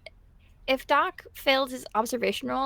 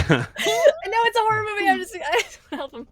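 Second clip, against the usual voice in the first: high-pitched talk broken by laughter; laughing; 8 semitones higher, 5 dB louder than usual. Real, not acted. Player excuses wanting to help him in a horror game, laughing. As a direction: laugh helplessly through the words.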